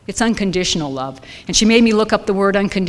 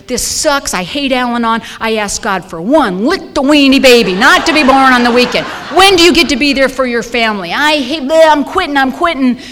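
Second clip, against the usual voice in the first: raised, higher voice acting out a tantrum on the phone; mock furious rant, pitched up; retold yelling; 4 semitones higher, 5 dB louder than usual. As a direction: replay the fit of yelling, a bit louder. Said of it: "I start yelling and screaming at her".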